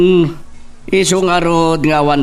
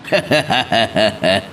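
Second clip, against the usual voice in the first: dismissive chuckle; short scornful laugh; mocking laugh; 4 semitones lower than usual. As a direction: laugh off the danger. Don laughs off death threat.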